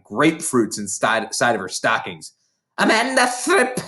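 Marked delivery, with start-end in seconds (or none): 2.8-3.8 high, bellowing call across the playground; shouting a pupil's name in a high, put-on voice; Trunchbull shouting. A narrator's reading. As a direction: yell the name like a furious headmistress. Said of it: "she was shouting".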